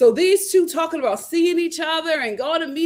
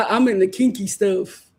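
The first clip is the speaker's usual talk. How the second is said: lower, louder mock flirt with a laugh; mock couple voice; 4 semitones lower, 6 dB louder than usual. Vlogger mocking the contestants' flirting, low and loud. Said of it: "They're both like".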